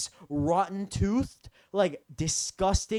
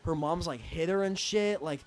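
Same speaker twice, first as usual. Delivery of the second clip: normal talking voice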